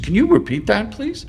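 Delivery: mock-stunned question climbing high at the end; retold disbelief. Patient asking the psychiatrist to repeat it, retold disbelief. Retelling his stunned reply.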